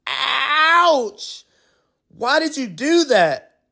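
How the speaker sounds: high, loud yelp of pain; pained outrage. Acted. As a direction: yelp after a poke in the nose. Poked in the nose.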